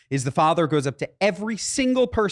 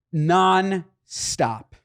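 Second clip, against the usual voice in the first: normal talking voice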